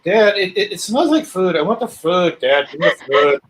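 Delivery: put-on lolcat voice, pleading with Dad; Samson. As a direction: whine for food like a pet cat. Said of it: "Samson would be like".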